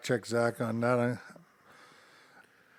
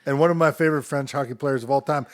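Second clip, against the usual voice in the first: normal talking voice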